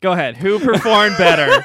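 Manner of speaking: high, loud, laughing demand; loud, high-pitched playful challenge. Real, not acted. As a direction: throw down the question at full volume.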